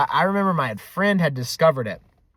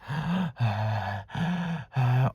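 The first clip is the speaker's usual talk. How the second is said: heavy creepy breaths into the mic; slow, deep gasping breaths; deep breathing; 5 dB quieter than usual. Retold or imitated. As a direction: imitate heavy prank-call breathing. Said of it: "he just starts breathing deeply".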